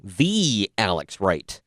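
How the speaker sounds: "The" stretched out long and slow; stress on The. Real, not acted. Podcaster announcing a favorite wrestler, "The" drawn out. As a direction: lean hard on "The", said as "thee". Mock reverence for the wrestler named.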